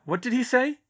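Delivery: high, shrill-free confused ask; lightly voiced bystander.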